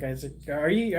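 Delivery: ordinary talk, nothing marked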